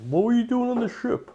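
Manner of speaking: high-pitched captain voice, curious; captain's question. Acted. Captain questions the stowaway boy in a high put-on voice. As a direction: ask it up high like the captain leaning in.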